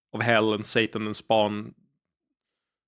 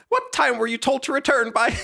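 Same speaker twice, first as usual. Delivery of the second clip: higher character voice; 12 semitones higher than usual. Shown as a demonstration. Streamer voicing the young master's scolding, higher pitched. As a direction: put on a snooty high-pitched master. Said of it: "I can't do that voice".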